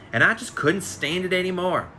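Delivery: ordinary delivery, nothing marked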